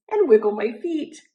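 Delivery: ordinary delivery, nothing marked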